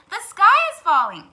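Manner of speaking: high loud alarm; crying alarm. Acted. Hen and duck cry the alarm to the turkey. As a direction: cry it out together. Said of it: "cried Henny Penny and Ducky Lucky".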